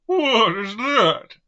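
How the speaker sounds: very high, very loud drawn-out hoarse cry; exaggerated hoarse voice. Shown as a demonstration. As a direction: overdo the hoarseness, stretched slow and high. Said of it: "That's also a hoarse voice".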